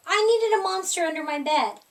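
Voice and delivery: ordinary delivery, nothing marked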